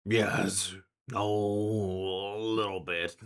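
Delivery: drawn-out monster reply; creature's sly admission. Acted. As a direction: admit it slowly, in a beastly voice. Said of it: "slowly turns around and looks at you and goes".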